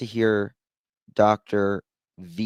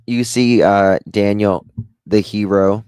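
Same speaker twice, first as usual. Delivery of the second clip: loud sarcastic announcement; booming mock praise of the hero; mock heroic; 9 dB louder than usual. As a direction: talk him up with heavy sarcasm. Mocking the interviewee loudly.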